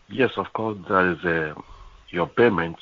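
ordinary delivery

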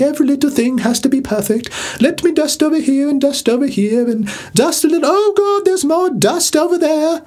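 very high, hammed-up perfectionist; fussy duster. Persnickety aunt going around dusting, high mock English fussing. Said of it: "imagine someone who's going around dusting".

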